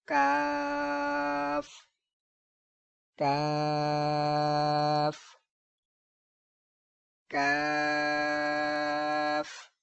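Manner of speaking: کاف with a light k and long thin "aa"; thin light pronunciation. Shown as a demonstration. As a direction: chant a drawn-out کاف, never heavy. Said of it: "اس کو باریک پڑھنا ہے".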